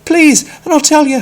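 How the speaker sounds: high, loud desperate plea; pleading cry. Acted. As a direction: beg frantically to get inside. Peter begging at the door.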